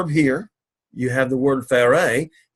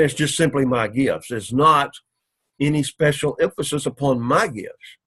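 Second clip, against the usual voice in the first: ordinary talk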